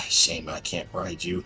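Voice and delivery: normal talking voice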